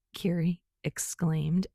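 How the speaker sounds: ordinary delivery, nothing marked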